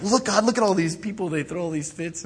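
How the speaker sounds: high-pitched tattling to God; playful, exaggerated complaint; mock tattling. Tattling to God about others.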